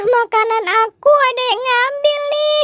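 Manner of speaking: high, squeaky cartoon voice, fretting; a frantic little creature who lost its snack; high squeaky voice, upset and whiny; high-pitched squeaky character.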